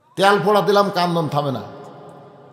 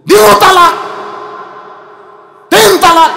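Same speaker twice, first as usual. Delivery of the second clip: high, loud furious outburst; angry shout; 13 semitones higher, 11 dB louder than usual. Acted. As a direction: bellow the verdict. Angry demand to divorce wife. (রাগে গর্জে ওঠা)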